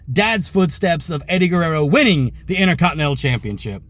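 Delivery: usual speaking voice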